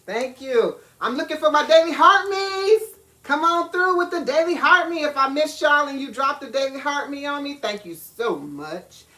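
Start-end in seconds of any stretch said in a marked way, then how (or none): 1.0-7.6 excited calling out. Real, not acted. Calling for Daily Heart Me's.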